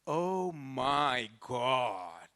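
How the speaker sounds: very slow, drawn-out oh my God; sitting in the car beside Swami, drawn-out awe; stunned awe. Real, not acted. Breathless, stretched-out wonder.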